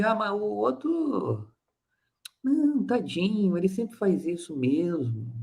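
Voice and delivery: pitying dismissal. Acted. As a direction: shrug it off with a sorry little sigh of a voice. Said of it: "é diminuir".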